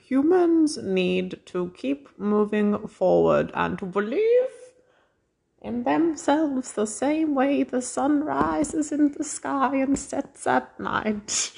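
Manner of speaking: high, slow sing-song witch. Pretend. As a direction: croon it like a storybook witch. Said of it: "You sound like a witch".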